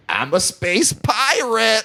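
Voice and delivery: high, slow, drawn-out proud proclamation; mock space pirate. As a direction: crow it slowly like a smug dork. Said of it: "he's like".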